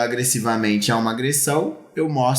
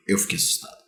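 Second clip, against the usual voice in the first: whispered; 5 dB quieter, faster than usual. Real, not acted. Telling boy he was scared.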